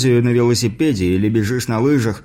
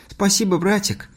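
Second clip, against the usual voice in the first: delighted little sister; 9 semitones higher than usual. A narrator's reading. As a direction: pitch it up into a joyful mock cry. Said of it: "обрадованно выкрикнула Поля". (радостный девчачий возглас высоким голосом)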